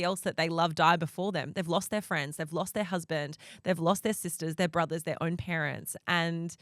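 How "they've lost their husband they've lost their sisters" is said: normal talking voice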